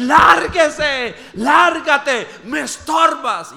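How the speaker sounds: high, loud shouted order to go away; retold furious yell. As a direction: shout him off like an angry seer. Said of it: "Y empieza a decir".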